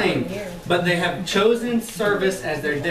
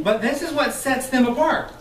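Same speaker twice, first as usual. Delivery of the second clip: normal talking voice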